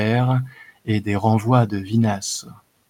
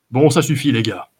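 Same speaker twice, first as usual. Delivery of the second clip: louder chief cutting in; firm order; 3 dB louder than usual. Acted. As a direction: call the gang off sharply. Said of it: "a renchéri le chef de bande". (chef qui coupe court, plus fort)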